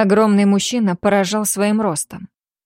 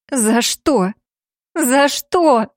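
rising hoarse cry; hoarse shout; 5 semitones higher, much slower than usual. Acted. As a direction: yell it out hoarsely. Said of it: "хрипло закричала". (хрипло кричит)

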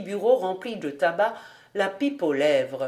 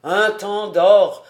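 loud, slow, savoured praise; enthusiastic exclamation; 8 dB louder, slower than usual. A narrator's reading. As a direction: draw it out loudly with relish. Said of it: "s'écria Hahn". (exclamation enthousiaste, appuyée)

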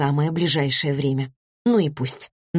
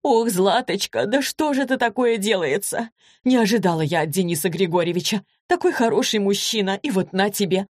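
higher-pitched, understated wailing; mildly tearful lament; 4 semitones higher than usual. A narrator's reading. Anna Zakharovna hears Zlata on the phone, mildly tearful lament. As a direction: lament it with a touch of tears. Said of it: "разрыдалась".

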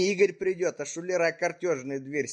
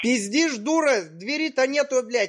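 angry shout; 8 semitones higher, 3 dB louder than usual. Acted. Prankster shouts back at grandma.

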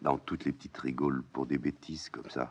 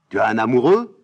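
higher and louder; shocked old nurse; 9 semitones higher, 16 dB louder than usual. Acted. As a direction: gasp it out as the nurse.

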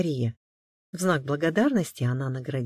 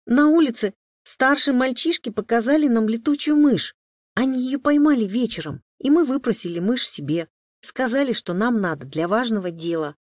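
put-on high, louder little boy's voice; confessing boy; 8 semitones higher, 7 dB louder than usual. A narrator's reading. Boy explains the bat to his father, childlike confession. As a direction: explain yourself like a child caught out.

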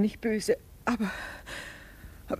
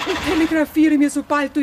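loud, higher and fast; fretting mother's loud urging; urgent insistence; 8 semitones higher, 8 dB louder, faster than usual. Acted. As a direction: press him, anxious.

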